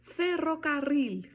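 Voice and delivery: FERROCARRIL slow, high, both RRs trilled; double rolled RR. Shown as a demonstration. Teacher challenging learners to say it, trilled ferrocarril. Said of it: "Tenta falar essa palavra".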